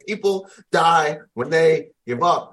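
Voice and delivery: hammering the lesson into a struggling soldier; firm retold warning. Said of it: "he just was like".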